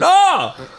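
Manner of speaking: high-pitched mock yell across a room; shouted from afar. Man calling to barista across the cafe, shouted from afar. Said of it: "From, like, ten meters away".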